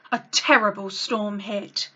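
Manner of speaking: usual speaking voice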